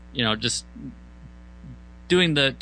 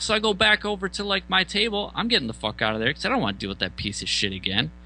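usual speaking voice

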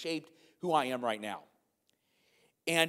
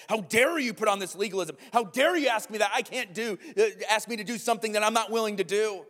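a mild mock outraged outburst, loud; indignant retort; 6 semitones higher, 5 dB louder than usual. Students protesting the professor's plea, in mock indignation. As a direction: retell the students' outrage with some heat. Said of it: "People began to stand up and shout".

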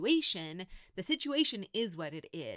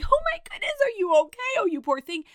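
high, loud mock alarm over a fallen child; hammed-up frantic mother rushing to a hurt kid; panicked mom; 12 semitones higher, 10 dB louder, much faster than usual. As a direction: gasp it out like a frantic parent, pitched way up and louder. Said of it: "I've run over and been like".

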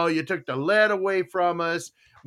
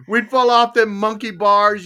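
usual speaking voice

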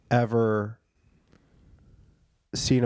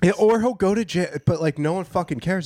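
excited; 7 semitones higher than usual. Real, not acted. Excited remark.

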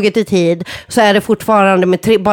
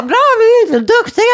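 voiced dogs; 14 semitones higher than usual. Voicing the dogs.

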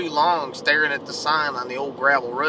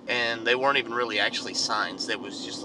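ordinary delivery

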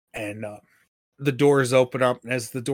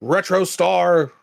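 drawn-out, higher-pitched upset call; rather upset; 6 semitones higher, 4 dB louder, slower than usual. Acted. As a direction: call it out slowly, mildly upset. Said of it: "he looks rather upset and he goes".

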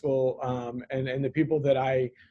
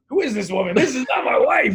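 high, loud astonished outburst; mock disbelief; 8 semitones higher, 7 dB louder than usual. Husband reacting in disbelief to his wife's plea, mock shock. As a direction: blurt it out in comic shock. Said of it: "I was like".

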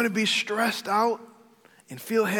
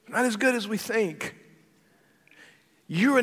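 raised, louder preacher's warning; fiery preaching; 5 semitones higher, 5 dB louder than usual. Acted. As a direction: call the congregation out from the pulpit. Preaching at full force.

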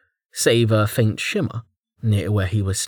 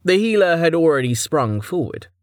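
ordinary delivery

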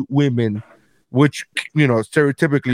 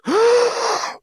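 high mock gasp of a shocked room. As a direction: gasp in horror, overplayed. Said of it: "the entire fucking room goes".

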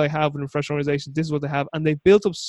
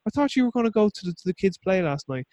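complaining wife; 6 semitones higher than usual. Retold or imitated. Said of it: "their wife goes".

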